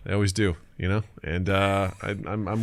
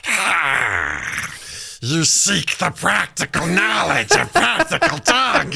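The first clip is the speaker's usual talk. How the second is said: high, slow, hammed-up cave goblin; a basement oracle demanding a price; 7 semitones higher, much slower than usual. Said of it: "some sort of, like, goblin".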